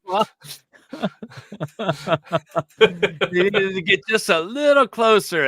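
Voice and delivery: high, loud words through laughter; laughing. Real, not acted. Host joking about the attraction, laughing. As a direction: giggle through the joke.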